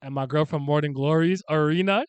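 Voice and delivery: ordinary talk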